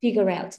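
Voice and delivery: figure out with the r linked into out; linking the sounds. Shown as a demonstration. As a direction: run Figure into out. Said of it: "nối âm nữa là nó hay nữa ha".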